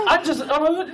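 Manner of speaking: ordinary talk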